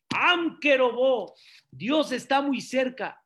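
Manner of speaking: high, impassioned sermon voice; heartfelt pulpit exhortation; preaching at full force. Acted. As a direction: plead with them to really talk to God. Pastor preaches at raised pitch.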